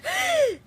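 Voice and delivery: sudden high gasp of shock; a startled, high intake of breath; sharp gasp. Acted. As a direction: catch your breath in fright. Sees something in the window.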